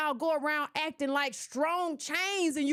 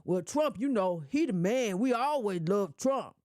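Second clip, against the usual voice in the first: low, dopey mock supporter voice; imitating a voter; 6 semitones lower than usual. Mocking a Kamala supporter's excuse, low and dopey. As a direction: play the naive supporter, deep and slow. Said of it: "tell me what they did to get you excited".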